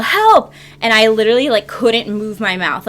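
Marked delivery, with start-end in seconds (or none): 0.0-0.5 high, louder yell of Help. Retold or imitated. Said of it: "trying to yell".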